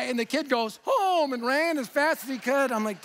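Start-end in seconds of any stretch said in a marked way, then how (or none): 0.8-1.3 high, loud frightened yelp; a kid bolting home in fright; scared kid. Retold or imitated. A scared boy answering Doug before running off, high yelp. Kid yells and runs away.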